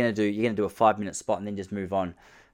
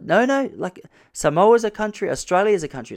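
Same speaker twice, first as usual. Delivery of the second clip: explaining; 9 semitones higher, 5 dB louder than usual. Retold or imitated. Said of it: "I was like".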